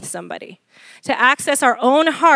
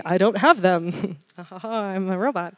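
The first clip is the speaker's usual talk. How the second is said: mock robot denial; 4 semitones lower, 7 dB quieter than usual. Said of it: "Some of you might be thinking".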